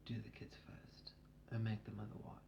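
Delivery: breathy unvoiced whisper, very soft. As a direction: lean in and whisper it. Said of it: "she says it in her whisper voice".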